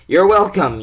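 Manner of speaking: loud, high yell. Acted. Streamer yelling thanks at viewers, loud and high. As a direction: shout it at the chat. Yelled at chat.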